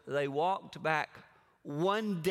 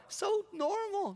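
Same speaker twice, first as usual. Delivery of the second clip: amused; slower than usual. Acted. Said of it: "comment on Jesus".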